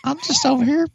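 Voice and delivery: cartoon voice. Joking cartoon voice on podcast.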